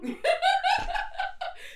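high, loud peals of laughter; big burst of laughter. Real, not acted. Russian group's name cracks up the guest, big laughter. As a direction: crack up completely.

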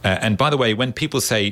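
ordinary talk

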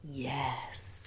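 soft whispered yes; whispering. Real, not acted. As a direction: breathe the answer. Whispered answer.

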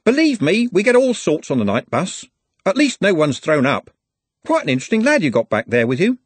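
a worldly driver amused; lightly voiced jovial driver; chatty bus driver. A narrator's reading. Bus driver chats with Glenda.

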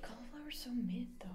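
half-whispered, teasing jab at cauliflower; breathy little whisper of mock disdain; whispered tease. Real, not acted. Quietly needling his girlfriend about her recipe, in a whisper.

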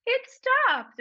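very high chirpy; high sing-song chirp; mock cheerful driver. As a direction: chirp it innocently. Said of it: "you're like".

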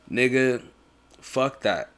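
ordinary delivery

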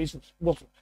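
usual speaking voice

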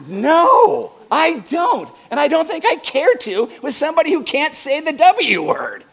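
hammed-up huff at folks who don't know the Fonz; mock outrage. Pastor playfully scolding the class in loud mock outrage. Mock indignant rant at audience.

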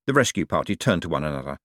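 ordinary delivery, nothing marked

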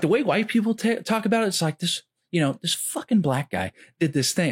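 pitched well up, a hammed-up Bill Burr rant; imitating Bill Burr. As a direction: push the voice high and animated, mocking a comic's bit. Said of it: "He's like, he's like".

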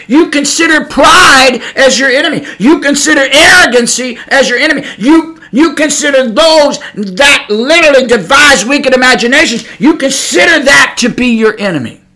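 fiery sermon voice building on each repeated line; preaching at full force. Acted. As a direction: preach it like a warning from the pulpit. Preaches against pride.

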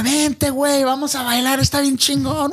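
high, playful come-dance call; imitating friend's invite. Friend calling him to dance, high and teasing. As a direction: lure him onto the dance floor.

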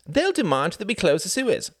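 prompt retort. Acted. Sam answering Reginald promptly. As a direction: fire it back promptly. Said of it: "said Sam promptly".

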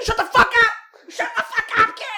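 high, loud panicked snap; frantic hush-yell. Retold or imitated. Mom silencing the kid near a bear, panicked loud snap. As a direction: yell at the kid to shut up, terrified.

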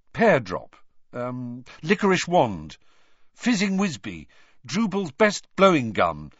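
angry guessing. A narrator's reading. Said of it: "he said angrily".

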